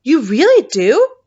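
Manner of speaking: excited giant. A narrator's reading. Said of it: "cried the B F G".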